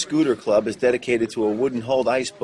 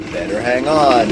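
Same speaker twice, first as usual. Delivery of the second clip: shaky ride voice; 5 dB louder than usual. Real, not acted. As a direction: voice jolted by a bumpy ride. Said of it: "Sorry for the shaky ride".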